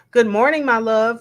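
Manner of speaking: reading it out with a lift of excitement; exclaimed greeting. Retold or imitated. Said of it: "with three exclamation marks".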